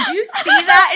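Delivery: high, loud retold disbelief; shocked question. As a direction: exclaim it to a witness, voice jumping up. Acting out her shocked question.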